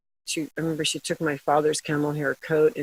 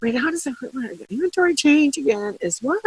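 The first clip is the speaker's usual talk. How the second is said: flustered confused self; 13 semitones higher, 4 dB louder than usual. Retold or imitated. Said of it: "I'm still saying".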